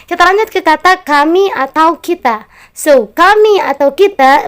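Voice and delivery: normal talking voice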